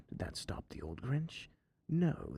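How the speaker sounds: ordinary delivery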